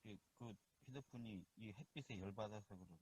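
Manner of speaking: barely audible phone line; faint connection. Real, not acted. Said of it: "아직도 너무 적은데". (멀리서 작게 들리는 통화 소리)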